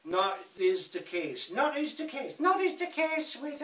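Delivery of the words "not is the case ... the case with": much higher, playful put-on voice; mock silly voice. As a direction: repeat it in a high, silly mock voice. Playfully repeats his own garbled phrase.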